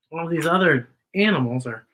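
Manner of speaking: ordinary delivery, nothing marked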